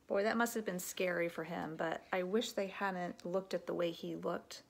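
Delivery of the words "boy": ordinary talk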